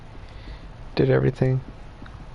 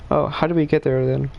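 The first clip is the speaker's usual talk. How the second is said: ordinary talk, nothing marked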